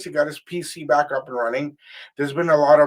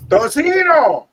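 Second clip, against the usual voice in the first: high, loud drawn-out call; 12 semitones higher, 11 dB louder, much slower than usual. Real, not acted. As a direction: call the name across the room. Calling co-host into show.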